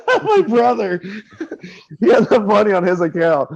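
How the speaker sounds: high, laughter breaking through the words; helpless giggling as the story continues; laughing while talking. Real, not acted. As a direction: crack up mid-sentence. Cracking up at own story.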